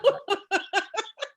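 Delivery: high laughter; laughing at the screaming joke; laughs. Real, not acted.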